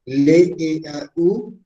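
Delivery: "L E A U" letra por letra, despacio; deletreo lento. Shown as a demonstration. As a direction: deletrea "L E A U" pausado. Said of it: "Cómo se escribe naturalmente". (deletreando "L E A U" despacio)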